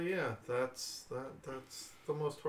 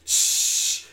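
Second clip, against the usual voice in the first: gas hiss; 13 dB louder than usual. Retold or imitated. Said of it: "it's like".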